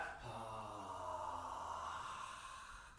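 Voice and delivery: a long, low, slow breath out through the mouth; empty the lungs in one soft sigh; a long, quiet sighing exhale. Shown as a demonstration. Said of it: "You're gonna exhale your breath".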